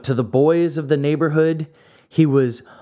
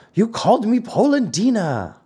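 high-pitched, offended retort; indignant accusation; 10 semitones higher than usual. A narrator's reading. Geppetto accuses the carpenter of name-calling, acted indignation. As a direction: throw the accusation back, pitched up. Acted quarrel over an insult.

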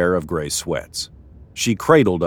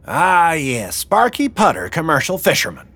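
high, knowing boss-woman voice; mocking gang boss; 8 semitones higher than usual. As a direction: size him up with mock recognition. Wilma mocks Sparky's innocence plea.

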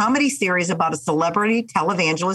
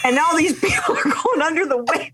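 high, laughing storytelling; bursting with laughter at it; a giggly high-pitched telling; excited laughing retelling; 10 semitones higher than usual. Real, not acted. Excited retelling of wave pool.

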